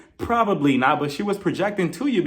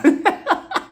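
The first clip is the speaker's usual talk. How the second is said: loud high laugh; 4 dB louder than usual. Real, not acted. Vlogger laughing at jumping Andy, loud high laugh. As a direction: laugh at your own joke.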